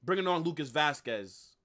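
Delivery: ordinary talk, nothing marked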